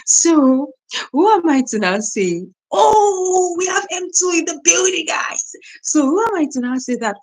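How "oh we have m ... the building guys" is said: high, loud hammed-up call-out; exaggerated high-pitched hype shout; mock announcement. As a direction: act out crowing to the whole room. Mock quote of a rude reaction.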